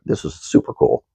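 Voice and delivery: voiced almost in a whisper, low; hushed aside. Telling viewers his own reaction, softly hushed. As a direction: breathe it out softly in awe. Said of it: "and I was like".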